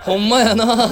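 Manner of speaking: high, loud mock Kansai exclamation; imitated Kansai dialect. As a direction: mimic kids trying out Kansai dialect. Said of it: "関西弁でこうだかって".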